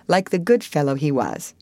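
ordinary delivery

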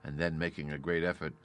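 ordinary delivery, nothing marked

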